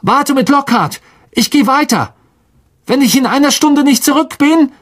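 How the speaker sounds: put-on high boyish voice, resolute; Harry's voice. A narrator's reading. Harry telling Ron he goes on alone: resolute boy's voice. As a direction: give the plan steadily. Harry continues his instructions.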